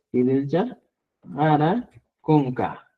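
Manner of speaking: spaced out word by word, stretched slowly; slow, measured example for learners; slow dictation. Shown as a demonstration. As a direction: dictate it slowly, drawing out each name.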